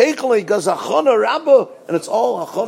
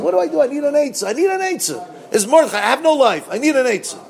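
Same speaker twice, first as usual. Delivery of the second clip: much higher, pleading whine of a panicked Haman; desperate Haman; 7 semitones higher, faster than usual. Acted. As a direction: beg your advisor for a plan, high and frantic. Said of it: "He goes to Zeresh".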